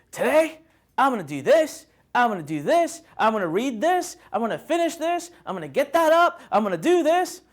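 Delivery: high, pumped-up mock pep talk to self; eager morning resolve.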